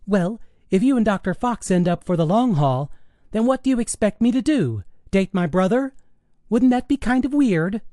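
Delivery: high, incredulous daughter's voice; high-pitched daughter pushing back; challenging teenage daughter. Acted. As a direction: argue back at Mom in a girlish pitch. Daughter argues with mother.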